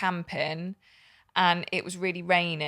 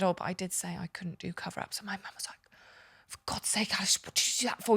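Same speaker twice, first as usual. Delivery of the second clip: whispered retelling; 5 dB quieter than usual. Retold or imitated. Retold exchange with mum whispered.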